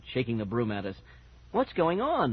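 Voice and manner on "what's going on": higher voice calling across a space; puzzled call from a doorway; lightly raised, pitched-up question. A narrator's reading. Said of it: "Mom called from the doorway".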